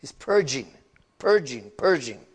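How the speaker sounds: impassioned sermon on cleansing water; rising preaching. Acted. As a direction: drive the point home like a pulpit preacher.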